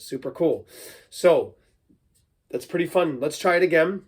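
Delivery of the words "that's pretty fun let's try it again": normal talking voice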